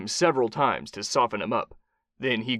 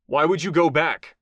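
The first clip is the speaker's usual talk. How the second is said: raised, insistent question; pressing; 5 semitones higher, 4 dB louder than usual. A narrator's reading. Kai presses the captive warlord, insistent. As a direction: press for the answer. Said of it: "Kai pressed".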